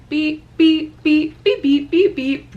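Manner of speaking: high, loud repeated beep noises; mimicked car beeps. Telling mom about the car locking, loud high mock beeps. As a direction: imitate the car alarm chirping.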